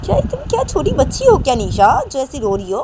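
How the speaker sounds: loud, rising reproach; exasperated scolding. A narrator's reading. Manisha to crying Nisha, exasperated. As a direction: tell off a grown-up who cries like a child.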